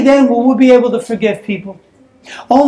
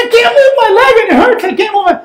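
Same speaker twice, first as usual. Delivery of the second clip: put-on whiny kid; 9 semitones higher, faster than usual. Boy tells mom.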